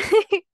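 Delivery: a quick delighted laugh; short burst of laughter. Real, not acted. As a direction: laugh at your own joke. Laughs after calling herself jungle woman.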